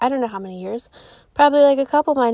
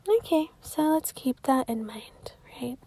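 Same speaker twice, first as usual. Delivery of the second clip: whisper; 9 dB quieter than usual. Real, not acted. Host whispering a reminder to listeners.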